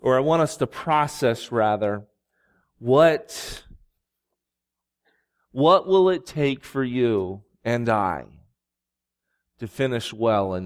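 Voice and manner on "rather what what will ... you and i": normal talking voice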